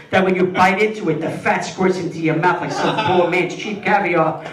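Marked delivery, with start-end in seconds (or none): none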